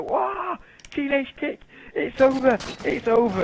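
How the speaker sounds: high, loud mock play-by-play; re-enacted commentator's excited cry; excited commentary. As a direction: call the finishing move like it ends the match. Re-enacting excited commentary on finishing move.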